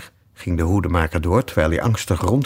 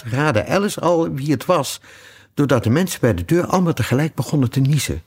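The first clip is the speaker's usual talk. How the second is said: usual speaking voice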